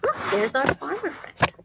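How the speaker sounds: plain reading voice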